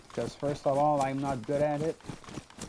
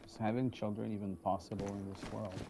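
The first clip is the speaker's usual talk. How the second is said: a lone survivor thinking aloud, quiet and lower; in-character survivor; 3 semitones lower, 8 dB quieter than usual. Acted. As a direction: muse to yourself while trekking alone, subdued.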